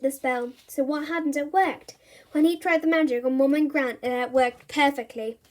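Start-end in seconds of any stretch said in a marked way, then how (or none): none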